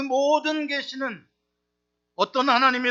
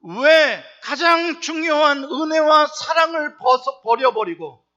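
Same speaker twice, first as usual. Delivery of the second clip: louder, slow shouted appeal; fiery preaching; 4 semitones higher, 8 dB louder, slower than usual. Real, not acted. Pastor pressing the congregation on grace, loud and slow. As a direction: thunder it from the pulpit.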